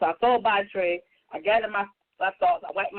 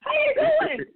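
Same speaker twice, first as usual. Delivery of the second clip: hammed-up dad greeting; much slower than usual. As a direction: belt the dad's overeager hello as a big mocking cry. Imitating dad's greeting every time.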